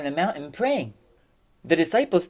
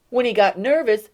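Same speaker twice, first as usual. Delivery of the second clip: normal talking voice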